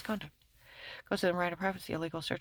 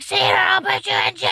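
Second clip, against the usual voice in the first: mock demand; 13 semitones higher, 13 dB louder than usual. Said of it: "And she's like".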